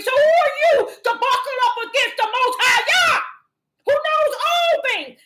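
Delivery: high, loud preacher's cry; loud, high, thundering rebuke from the pulpit; fiery preaching. Acted. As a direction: preach it at full force, rebuking. Preaching at a hearer.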